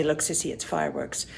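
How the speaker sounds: quiet aside. Retold or imitated. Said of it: "wouldn't just say".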